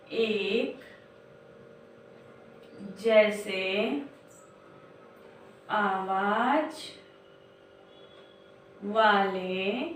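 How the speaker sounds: each word drawn out slowly, long pauses between; slow dictation. Shown as a demonstration. Teacher dictating slowly to young children. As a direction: spell it out word by word like dictation to a class. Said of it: "ध्यान से आप लोग देखिए".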